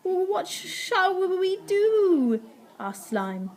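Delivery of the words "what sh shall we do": high, stammered and drawn-out fright; acted fearful wail, very slow; scared. A narrator's reading. Slime asks Ned amid circling cats, scared. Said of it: "the pair were scared".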